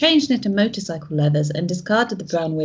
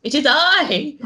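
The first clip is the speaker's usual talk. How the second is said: grand reveal; 8 semitones higher, 4 dB louder, slower than usual. Acted. Dramatic villain reveal.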